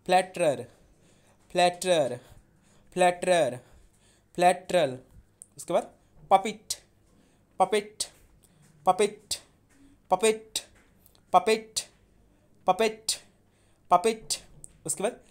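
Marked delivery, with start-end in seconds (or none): 0.1-5.0 each flatterer said louder and separately with a falling end; louder pronunciation drill. Shown as a demonstration. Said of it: "pronunciation सही कीजिएगा।". 6.3-14.4 puppet repeated higher and louder with a rising end; louder, higher drill. Shown as a demonstration. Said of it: "pronunciation सही कीजिएगा।".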